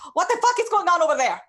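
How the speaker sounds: high, loud, fast yell; shouting from another room. Acted. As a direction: holler it through the house, demanding answers. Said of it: "kind of shouting from the other room".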